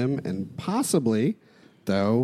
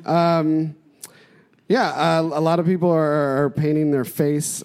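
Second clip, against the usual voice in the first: louder, higher to carry; speaking up; 4 semitones higher, 4 dB louder than usual. Acted. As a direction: project so they hear. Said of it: "can you speak up".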